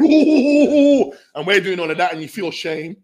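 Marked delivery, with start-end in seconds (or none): 0.0-1.1 high loud teasing "ooh"; mocking ooh. Lads teasing a mate over his cap, loud mocking ooh. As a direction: jeer at him with a long ooh. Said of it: "man is just laughing, going".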